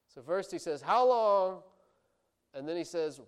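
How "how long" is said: loud, high, drawn-out cry; calling out. Acted. Preacher voices the prophet's first question, loud crying-out. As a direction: throw the question up at God. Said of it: "he's calling out to God".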